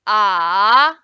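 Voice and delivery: loud, long dipping "ah"; third tone. Shown as a demonstration. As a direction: draw out "ah" down, then up. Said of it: "third tone is going down, then going up".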